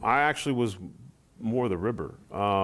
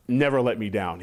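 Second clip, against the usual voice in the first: ordinary talk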